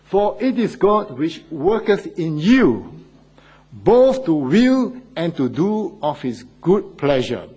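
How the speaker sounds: slow, emphatic Bible reading; scripture reading. Real, not acted. Preacher reads a verse for listeners, slow emphatic scripture reading.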